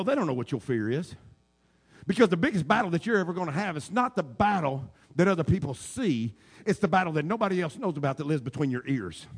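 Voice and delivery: ordinary delivery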